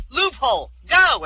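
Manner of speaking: high, loud order to a dog; shouted command. Acted. Lawyer commanding his dog in the finals, shouted order. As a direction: shout the cue, pitched well up.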